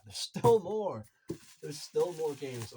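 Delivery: slow, loud and delighted; drawn-out thrilled announcement; excited. Real, not acted. More games keep turning up.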